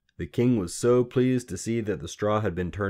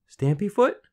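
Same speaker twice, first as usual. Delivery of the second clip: put-on high, louder questioning girl; tentative guess; 14 semitones higher, 7 dB louder than usual. A narrator's reading. Queen guessing the little man's name, tentative. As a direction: try the name out, pitched right up.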